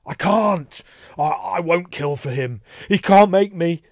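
high, loud, panicked protest; frightened stammering outcry; desperate refusal. A narrator's reading. Terrified man refusing to kill.

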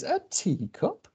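high, drawn-out questioning; surprised rabbit. Acted. Rabbit hearing there's a teacup in the suitcase, surprised. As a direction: echo it high and slow in disbelief.

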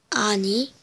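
ordinary delivery

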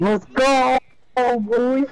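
high, loud hype shout; hyped gamer bro. Real, not acted. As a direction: cheer it like you won. Hyped over loot.

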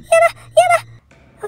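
panicked high wail; frightened pleading cries; hit hard crying out. Real, not acted. Scared gamer panicking at danger.

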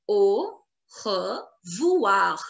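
very slow Au, re, voir with pauses; syllable by syllable. Shown as a demonstration. Teacher models the written form, Au revoir very slowly split. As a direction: stretch Au revoir out sound by sound.

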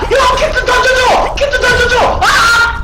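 high, loud frantic yell; desperate shout. Real, not acted. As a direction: scream for help, panicked. 키트 달라고 절박하게 외침. (키트 달라고 절박하게 외침)

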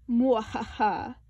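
slow villain cackle in another voice; wicked laugh. A narrator's reading. Sister playing Lord Grizzly Mort, wicked slow cackle. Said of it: "Sister laughed wickedly".

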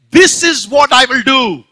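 loud, slow boast from a high place; rich fool boasting aloud. Acted. The rich fool standing on a high place, loud slow boast. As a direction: proclaim it to the whole town. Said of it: "That's how he said it".

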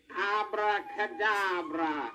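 slow, drawn-out magic words; magician casting a spell. Acted. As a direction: intone the spell slowly like a wizard. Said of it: "He said".

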